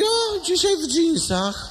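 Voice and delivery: very high, very slow sing-song reply; dim nurse voice. Acted. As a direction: answer sweetly as a clueless nurse. Nurse's absurd answer. (bardzo wysoko i bardzo wolno, głupiutka pielęgniarka)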